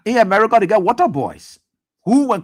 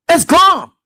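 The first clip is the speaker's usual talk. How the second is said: higher and louder, final; loud insistence; 8 semitones higher, 6 dB louder than usual. Real, not acted. Caller insisting their future is gone, loud. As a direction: slam it down loud.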